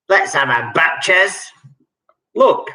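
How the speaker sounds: breezy London lad; Cockney accent. Shown as a demonstration. Quizmaster acting out slang for players, light Cockney. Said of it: "I will try and do a Cockney accent".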